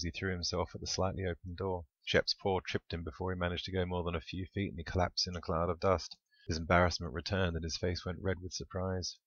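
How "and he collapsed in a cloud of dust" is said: plain reading voice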